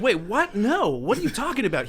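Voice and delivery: high, loud retold disbelief; baffled protest. Retelling his reply to a false accusation, baffled.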